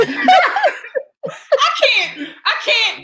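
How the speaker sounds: high, helpless laughing protest; laughing hard. Real, not acted. Co-host cracking up at a silly accent, helpless high laughter. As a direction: squeal it through real laughter.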